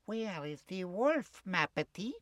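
soft, unruffled question; cat husband. Acted. Cat comes home to his alarmed wife, soft questioning. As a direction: ask it quietly, indulgently.

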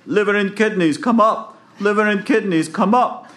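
high, loud storybook holler; yelling down. Acted. Jack calling down under the bridge, high yell. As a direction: holler it down to someone far below. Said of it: "So he yells down".

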